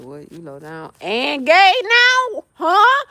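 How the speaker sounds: imitating his taunt, slow; a hint of his jeering tone; mocking quote. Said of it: "for him to throw that out there like".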